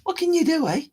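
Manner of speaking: high, resigned shrug; mock resigned. Real, not acted. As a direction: throw it away with a sigh. Playful resigned aside.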